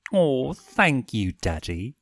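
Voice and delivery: mischievous simpering owl. A narrator's reading. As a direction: tease him with a sugary simper. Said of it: "he simpered".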